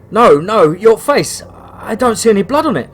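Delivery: loud, high, panicked protest. Acted. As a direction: cry out in fear at the sight. Boy panics at the nurse's face.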